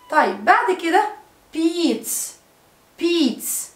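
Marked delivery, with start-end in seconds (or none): 1.5-3.7 "بيتس" ending in a long voiceless ts; final s sound. Shown as a demonstration. Teacher showing s after t, "بيتس" ending in s. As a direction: model "بيتس" as beets, with an s ending. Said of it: "لما يبقى اخر الكلمة تي".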